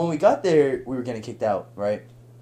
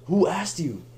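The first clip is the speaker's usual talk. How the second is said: higher-pitched mock snap; retold snapping; 6 semitones higher than usual. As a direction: imitate an irritated retort, raised in pitch. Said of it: "didn't mean to yell at you".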